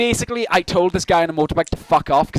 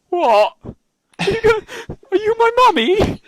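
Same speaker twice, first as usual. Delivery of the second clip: put-on much higher, small lost child voice; mock childlike; slower than usual. Teller mocks his own reply to the mugger, childlike and much higher. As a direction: squeak it like a lost little kid. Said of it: "It was".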